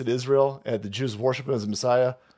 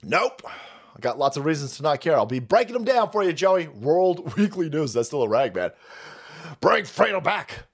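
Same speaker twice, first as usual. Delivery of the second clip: ordinary delivery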